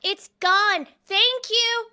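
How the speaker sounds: high, loud, pleased announcement; cheerful child character. Acted. Totoi thanking Cleven for gumball, bright childlike glee. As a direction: pipe up loudly with gratitude.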